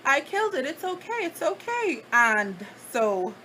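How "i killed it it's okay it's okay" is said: much higher sing-song baby talk, imitating the cousin. Cousin comforting the narrator in the tub, high cooing baby talk. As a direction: coo it up high like hushing a crying baby. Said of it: "She's like".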